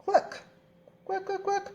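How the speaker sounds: high-pitched little hen clucks; clucking hen. Acted. Teacher gives pupils a riddle as the hen, high clucking. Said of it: "I say".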